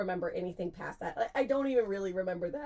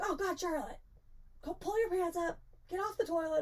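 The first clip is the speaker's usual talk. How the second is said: high-pitched mock dismay; exasperated roommate; 10 semitones higher than usual. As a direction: imitate a flustered friend giving orders, voice pitched up. Roommate finds her fallen, retold.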